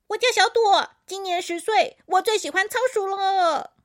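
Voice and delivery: high, loud, perky kiddie voice; high-pitched, excited recital; little hamster child. A narrator's reading. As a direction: introduce yourself with bubbly eagerness. Little hamster introduces herself.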